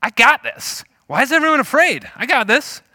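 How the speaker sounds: high, loud, breezy self-assurance; cocky young David. Acted. David to frightened onlookers, cocky and high. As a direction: brush off the danger, loud and high. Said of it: "David's attitude is".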